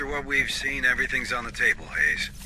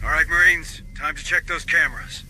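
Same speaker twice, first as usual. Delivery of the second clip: loud, higher call to the Marines; calling the squad; 6 semitones higher, 5 dB louder than usual. Acted. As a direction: raise your voice to get the squad moving. Sergeant calling squad to action.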